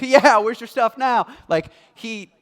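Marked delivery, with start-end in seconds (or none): 0.0-1.3 loud, high gloating jeer; mocking taunt. Shown as a demonstration. Preacher showing how God does not say it, a loud mocking taunt. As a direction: rub it in like a schoolyard taunt. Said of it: "he doesn't say it like".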